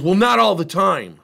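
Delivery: loud, high defensive hedge; sheepish admission. Retold or imitated. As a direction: concede it a bit too loudly. Worker admits griping, retold.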